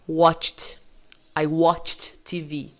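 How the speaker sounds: WATCHED slowly as "watcht", one syllable. Shown as a demonstration. Teacher models the past of watch, ED as T. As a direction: tack a T onto the CH of WATCHED. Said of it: "Então, no passado, vai ficar".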